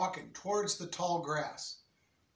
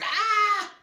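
put-on loud high child's scream; 9 dB louder than usual. Kid spots something moving, loud scared scream. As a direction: shriek in fright. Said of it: "one of the kids screamed".

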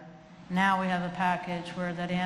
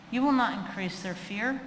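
ordinary delivery

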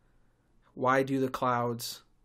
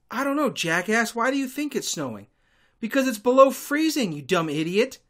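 much higher and louder, scornful and fed up; exasperated rant; 8 semitones higher, 6 dB louder than usual. Acted. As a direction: tell the jackass off. Insulting the questioner in hindsight.

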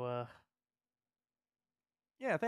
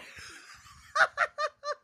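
high burst of laughter; real surprised giggle; sudden high laugh; 8 dB louder than usual. Real, not acted. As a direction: crack up at your own weird noise. Bursts out laughing at own noise.

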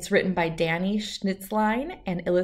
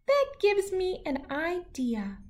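high, thoughtful little voice; a tiny shrew hatching a plan; 11 semitones higher, 4 dB quieter than usual. Acted. Shrew gets an idea, tiny high voice.

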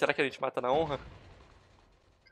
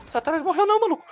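high, loud, rising shout; high-pitched gamer panic; alarmed outcry; 14 semitones higher, 6 dB louder than usual. Real, not acted. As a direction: cry out in disbelief. Enemy will not die.

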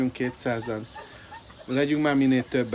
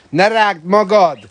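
sharp scold; 6 semitones higher, 9 dB louder, slower than usual. Real, not acted. Scolding the swan.

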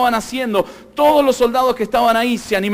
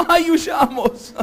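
mock alarmed cry; 4 semitones higher, much slower than usual. People fleeing from you.

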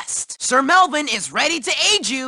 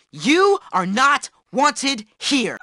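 low, deliberately spaced words; slow and condescending; 4 semitones lower, slower than usual. Acted. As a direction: spell it out for a dimwit. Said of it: "I'm going to say it slow".

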